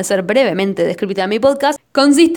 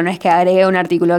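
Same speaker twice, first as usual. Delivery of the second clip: ordinary delivery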